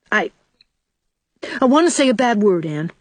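put-on small boy's reluctant confession; blurted out. A narrator's reading. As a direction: force it out with a desperate effort. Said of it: "blurted out Davy, with a desperate effort".

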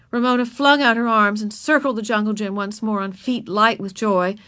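ordinary delivery, nothing marked

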